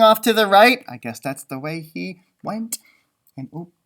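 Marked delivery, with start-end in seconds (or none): none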